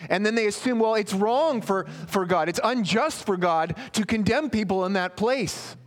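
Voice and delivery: pushing the point with rising heat; fervent preaching. Acted. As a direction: preach it hard to the crowd. Preacher building to full force.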